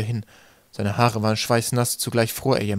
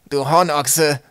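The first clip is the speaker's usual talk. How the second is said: loud, raised-pitch insult; angry scolding; 4 semitones higher, 6 dB louder, much slower than usual. A narrator's reading. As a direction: scold the clumsy stranger crossly. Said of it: "schimpfte er".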